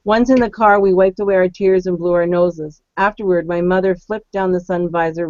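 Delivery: story narration. Acted. Reading a submitted story aloud.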